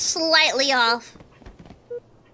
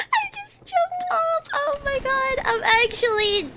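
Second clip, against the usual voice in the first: very high squeal through laughter; helpless laughter; 11 semitones higher than usual. Real, not acted. Gamer laughing at jumping off by accident, helpless laughter. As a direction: barely get it out, squeaking with laughter.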